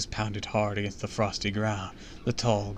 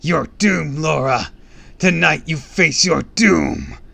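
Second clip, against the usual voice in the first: loud, raised monster voice; furious beast roar; 6 semitones higher, 12 dB louder, slower than usual. A narrator's reading. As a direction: roar a slow doom at your enemy. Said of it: "Let out a furious roar".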